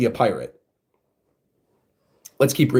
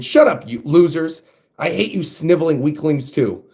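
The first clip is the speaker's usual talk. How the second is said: ordinary delivery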